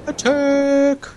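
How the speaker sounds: high-pitched repeated attack call; high battle cry. Real, not acted.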